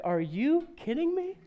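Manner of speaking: high, incredulous are you kidding me; mock disbelief at the toddler. Parents reacting to their daughter's lip rolls, high disbelief. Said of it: "my wife and I look at each other like".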